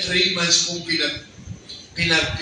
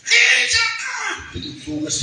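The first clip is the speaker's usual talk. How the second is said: the possessed woman screams a challenge; demon shouting. Retold or imitated. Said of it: "nagtingin siya".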